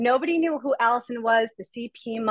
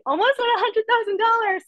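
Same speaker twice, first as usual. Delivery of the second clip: excited; 11 semitones higher, 3 dB louder than usual. Real, not acted. Excited about nearing six figures.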